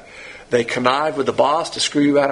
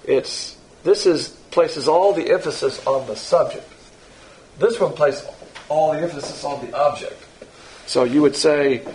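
ordinary talk, nothing marked

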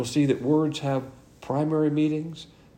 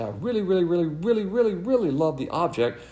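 over-the-top gushing repetition; gushing really really; 5 semitones higher than usual. Shown as a demonstration. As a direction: gush over it like you mean it. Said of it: "you'd use philos".

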